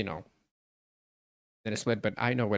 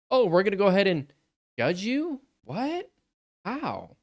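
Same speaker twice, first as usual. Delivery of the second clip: high, loud mock outrage; mock incredulity; 9 semitones higher, 6 dB louder than usual. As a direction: act baffled, pitch leaping up on each question. Mock outrage at being judged.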